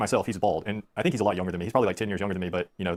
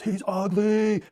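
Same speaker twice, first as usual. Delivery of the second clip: put-on high, slow, taunting child's shriek; drawn-out high kid yell; kid screaming; 11 semitones higher, much slower than usual. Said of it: "He just starts screaming".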